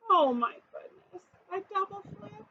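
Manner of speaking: a high, breathless "a double flip?"; shocked question. Acted. Nancy watches the tray fall, shocked and breathless. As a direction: gasp it out in disbelief. Dismay over the falling tray.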